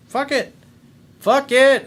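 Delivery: high, loud fed-up burst; fed-up outburst. Retold or imitated. Dumped guy blurting a loud, fed-up fuck it. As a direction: throw it away with a loud high snap. Imagined guy giving up on her.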